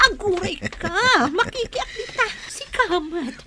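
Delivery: high playful giggling tease; giggly flirting. Acted. Mistress teasing her lover with high giggles. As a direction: laugh and tease him coyly.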